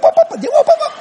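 high rapid-fire "whoop-bop-bop"; imitated whooping. Preacher mimics woman interrupting his worship, high whooping. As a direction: babble high repeated syllables. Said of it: "That's all she could ever say".

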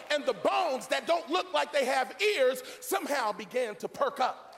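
impassioned sermon in surging phrases; full-force preaching. Acted. Preacher rousing church over dead bones, full-force preaching.

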